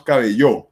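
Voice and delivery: cabello mis-stressed on the last syllable, cabe-LLÓ; wrong stress. Shown as a demonstration. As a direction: throw the weight onto the -llo of cabello. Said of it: "ni decimos cabello".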